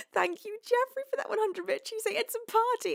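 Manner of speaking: lifted, airy voice; bright high gratitude; high, light thanks. Real, not acted. Thanking another cheer.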